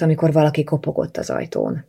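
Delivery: ordinary delivery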